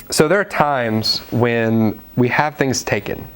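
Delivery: ordinary talk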